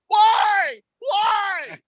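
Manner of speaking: high, shouted despair; anguished wail. Retold or imitated. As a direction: wail at the sky in agony. Newcomer suffering summer heat.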